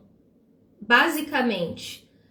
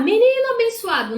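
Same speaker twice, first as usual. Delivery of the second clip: much higher, louder sarcastic shout; ironic yell; 11 semitones higher, 5 dB louder than usual. Retold or imitated. Mother sarcastically yelling at son, ironic shout. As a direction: yell it with biting irony. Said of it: "você tá sendo irônica".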